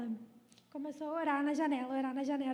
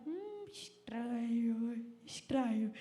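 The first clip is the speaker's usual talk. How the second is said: low, drawn-out pondering; acted suspicion, very slow and low; suspicious musing; 5 semitones lower, 3 dB quieter, much slower than usual. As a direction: mull it over slowly, deep in your voice. Acting out mother's suspicion at silence.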